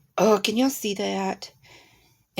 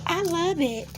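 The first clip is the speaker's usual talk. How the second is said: retold delighted squeal; 11 semitones higher than usual. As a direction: replay her own thrilled reaction. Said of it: "And I said".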